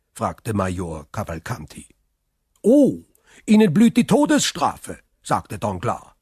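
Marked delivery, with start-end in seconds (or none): 2.6-5.0 high, loud, breezy answer; emphatic exclamation. Acted. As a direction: pronounce it with relish. Guest eagerly answers the question. (laut und hoch ausgerufen)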